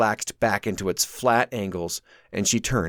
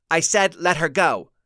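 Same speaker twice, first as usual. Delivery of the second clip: plain reading voice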